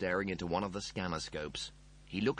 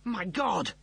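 shocked; 10 semitones higher, 4 dB louder than usual. Acted. Rimmer shocked by news.

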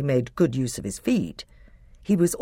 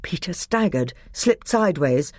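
plain reading voice

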